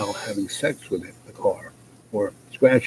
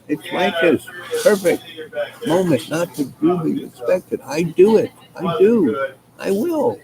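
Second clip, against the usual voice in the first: high, eager, climbing pitch; officer swept up in his own big moment, high and excited; giddy rising plea; 7 semitones higher than usual. Acted. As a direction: sell it like a man finally chosen.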